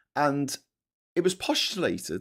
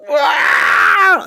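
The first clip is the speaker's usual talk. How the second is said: high, loud hammed-up crying wail; a shrill fake tantrum wail; mock wail; 14 semitones higher, 11 dB louder than usual. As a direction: bawl like a spoiled brat. Imitating spoiled girl crying.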